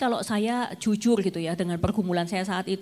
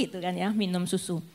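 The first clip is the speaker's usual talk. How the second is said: ordinary talk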